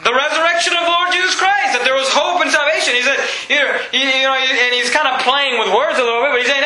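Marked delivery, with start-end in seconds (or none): none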